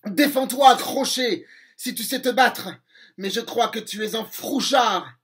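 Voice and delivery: défi crié, plus aigu et plus fort; provocation. Acted. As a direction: narguer le pirate. Peter provoque Crochet au combat. (Peter nargue Crochet en criant)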